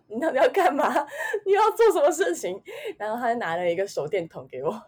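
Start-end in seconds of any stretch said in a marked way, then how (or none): 0.1-2.6 laughing exasperation. Retold or imitated. Exasperated inner thought, laughing.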